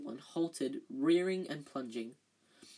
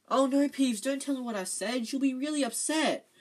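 high, loud, urgent pleading; frantic; 9 semitones higher, 7 dB louder than usual. A narrator's reading. Hermione begging Peeves not to tell, frantic. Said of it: "Hermione whispered frantically".

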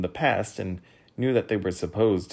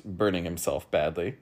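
usual speaking voice

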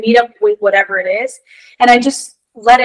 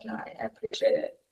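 whisper; 18 dB quieter than usual. Real, not acted. Reply to teasing aside.

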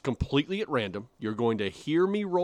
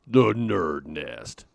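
low, loud, very slow hype voice; monster truck announcer; 6 semitones lower, 6 dB louder, much slower than usual. Pretend. Host selling the giveaway name, monster truck announcer. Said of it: "kinda like the monster truck guy".

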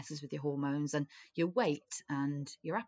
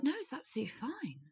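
quick, airy reassurance, a bit softer; softly spoken friend; 8 semitones higher, 5 dB quieter, faster than usual. Retold or imitated. Rosie reassuring her friend, soft and quick. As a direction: lightly play dainty Rosie brushing it off. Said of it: "'cause she's just so softly spoken".

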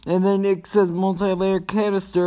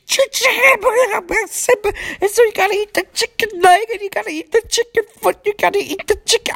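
very high put-on comedian voice; squeaky high celebrity impression; Bill Cosby. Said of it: "I'm trying to get the Bill Cosby".

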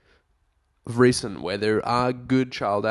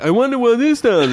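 put-on childlike squeal, much higher and louder; high squeaky kid voice; 13 semitones higher, 7 dB louder than usual. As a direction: play the naive kid pilot, pitched way up. Anakin voiced in retold scene.

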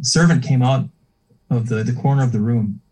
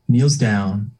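ordinary talk

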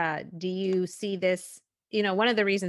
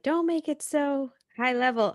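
higher-pitched imitation of a scolding husband; lightly nag, pitched up; mock scolding; 7 semitones higher, 3 dB louder than usual. Host quotes husband's complaint about her show, mock scolding. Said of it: "scolds me about".

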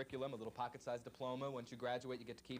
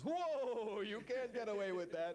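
much higher, slower, alarmed inner voice; inner brain voice; 10 semitones higher, 4 dB louder, much slower than usual. Retold or imitated. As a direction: voice your brain catching the mistake, high and drawn out. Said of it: "the brain goes".

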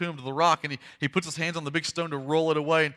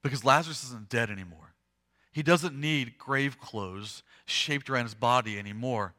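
ordinary delivery

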